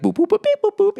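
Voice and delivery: high electronic boop-beep noises; robot beeps. Pretend. A robot butler arriving with liquid food, high beeping boops. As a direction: beep like a clunky old sci-fi robot. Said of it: "is the robot still gonna be like".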